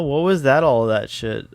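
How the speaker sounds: ordinary talk, nothing marked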